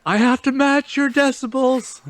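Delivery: very high, stretched-out silly voice; mock yelling. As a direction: squeal it high, playing along with the joke.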